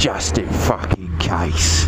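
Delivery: slow, menacing bike-bouncer voice; Dave's voice. Retold or imitated. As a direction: lean on it like a bouncer. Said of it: "says Dave".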